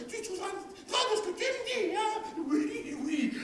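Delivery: high, plaintive woman's voice, half crying; wife's high whining lament. Acted. Wife protesting to her husband, high and tearful. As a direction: whine and protest like a fretful peasant wife.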